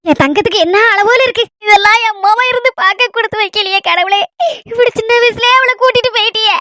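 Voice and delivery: grieving wail between sobs; tearful lament. Acted. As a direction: lament to God through tears. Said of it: "ஏத்தே, அழாதீங்க தே".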